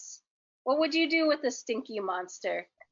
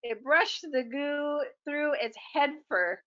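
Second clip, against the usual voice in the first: ordinary delivery, nothing marked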